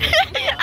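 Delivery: brief high-pitched laugh; quick giggle before speaking; real high giggle. Real, not acted. Laughs at the sisters' talk.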